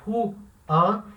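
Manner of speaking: Juan split into two syllables as ju-an, slow. Shown as a demonstration. Teacher showing the Brazilian mistake on Juan, slow. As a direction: pull Juan apart as ju-an. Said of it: "a vontade de dividir".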